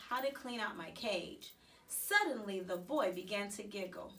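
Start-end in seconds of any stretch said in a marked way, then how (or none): none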